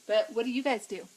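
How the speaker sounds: ordinary delivery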